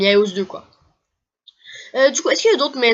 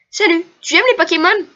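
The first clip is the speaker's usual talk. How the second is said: put-on much higher and louder, bright little girl; cutesy girl voice; 8 semitones higher, 6 dB louder than usual. Gamer voices the copycat girl greeting the player, high girly voice. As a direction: chirp it like an excited kid.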